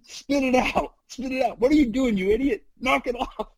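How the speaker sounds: high, urgent mock yell; retold yell. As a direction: bark it like stopping a kid swallowing pills. Companion yells at pill-swallower.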